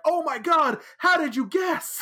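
high-pitched squeal of pretend surprise; mock amazement. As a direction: gush as if truly astonished, hammed-up. Retold mock amazement at being named.

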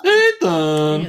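high, loud drawn-out mimicked call; mock calling out. Co-host butts in as a game character, mock calling out. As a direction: call the name out high and long, in imitation.

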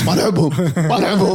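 high, playful laughing; laughing mock grudge. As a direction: tease with a laugh. Mock rivalry joke.

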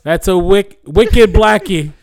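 high, slow, loud fake Boston; mock Boston accent. Imitating a Boston fan eyeing the disguise, mock Boston accent. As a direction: drag it out in bad Boston. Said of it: "Boston accent".

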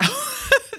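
high burst of amazed laughter; delighted laugh. Real, not acted. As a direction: laugh out in happy disbelief.

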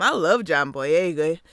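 ordinary talk, nothing marked

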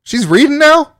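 high, loud disbelief; genuinely stunned question; surprised. Real, not acted. News that his niece reads.

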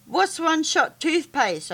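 put-on boy shouts his question up high. A narrator's reading. Boy shouting at Monty across the lab, high. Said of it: "I shouted".

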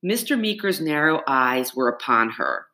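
plain reading voice

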